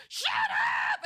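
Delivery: high, loud re-enacted yell; angry shout. Resident yelling at cars that can't hear, angry shout. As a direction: yell it like you're fed up. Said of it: "sometimes I'm so angry".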